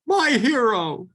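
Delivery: high, breathless damsel voice; swooning damsel. Acted. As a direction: sigh it like a rescued heroine.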